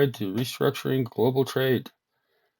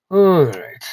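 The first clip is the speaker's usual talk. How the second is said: loud reset; 6 semitones higher, 13 dB louder than usual. Real, not acted. Snapping back to work.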